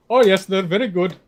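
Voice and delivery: mock Indian accent. Caller bluffing about the hockey team, in a mock Indian accent. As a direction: agree politely in a fake Indian accent. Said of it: "a Chicago in India".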